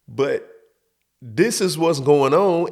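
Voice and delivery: ordinary talk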